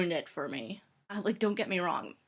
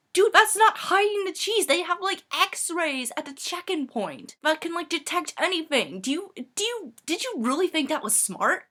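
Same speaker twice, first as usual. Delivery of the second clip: high, loud, incredulous rant; acted-out disbelief, pitch way up; exasperated scolding; 9 semitones higher, 5 dB louder than usual. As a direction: tell off a foolish friend. Scolding friend over hiding cheese.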